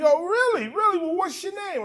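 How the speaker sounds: high sarcastic cop voice; mocking cop. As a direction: needle him like a smug cop, pitched high. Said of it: "I'm like".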